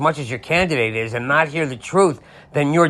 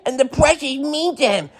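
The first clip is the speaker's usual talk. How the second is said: much higher whiny crybaby voice; crybaby whine; 12 semitones higher than usual. Retold or imitated. Mocking Trump's people as crybabies, high whine. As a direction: whimper like a crybaby. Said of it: "they're crybabies".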